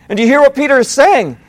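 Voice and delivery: ordinary talk, nothing marked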